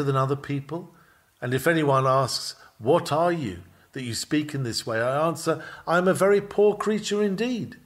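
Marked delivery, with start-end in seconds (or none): none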